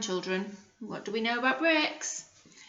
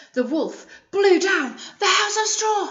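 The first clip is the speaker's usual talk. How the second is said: higher and louder storyteller climax; dramatic reveal; 8 semitones higher, 5 dB louder than usual. A narrator's reading. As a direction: make the blow land big.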